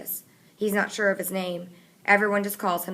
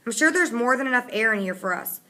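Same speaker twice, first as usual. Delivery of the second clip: irritated; 5 semitones higher, 5 dB louder than usual. A narrator's reading. Connor snapping at M B.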